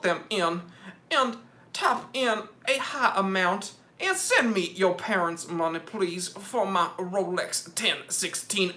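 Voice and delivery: Southern preacher-rooster begging for money; hammed-up Southern donation plea; Foghorn Leghorn. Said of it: "like, a foghorn leghorn".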